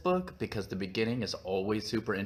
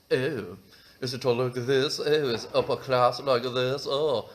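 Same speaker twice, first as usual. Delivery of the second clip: loud mock upper-class British; caricatured upper-class English; upper-class London accent; 3 semitones higher, 5 dB louder than usual. Shown as a demonstration. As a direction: put on a hammed-up toff's London accent. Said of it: "London accent is the more upper class".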